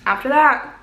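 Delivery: talking around a hot bite; mouth full of hot food. Real, not acted. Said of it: "Sorry, this is really hot".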